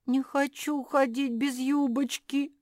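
high, loud whine; moaning whine.

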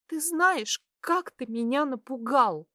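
put-on high, upset girl's outburst; tearful reproach. A narrator's reading. Girl telling the patient how scared she was, tearful reproach. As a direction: reproach him, voice pitched right up.

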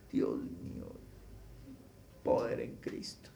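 hushed, breathy aside to God; whispered prayer. Real, not acted. Preacher whispering a prayer aside to himself, hushed.

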